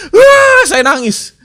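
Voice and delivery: a mock sob on a high, loud wail; retold crying. Preacher recalls crying before his teacher, mock sobbing. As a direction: replay the childhood crying, half laughing.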